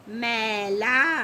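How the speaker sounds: मैला very slowly as mai, laa; drawn-out ai. Shown as a demonstration. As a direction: stretch both syllables of मैला. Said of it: "ए की मात्रा है वो इस तरह".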